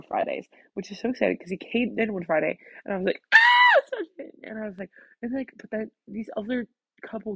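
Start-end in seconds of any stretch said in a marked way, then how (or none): 3.3-4.3 loud, high squeal of joy; excited shout. Retold or imitated. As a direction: call his name out delighted. Excited greeting of returning teacher.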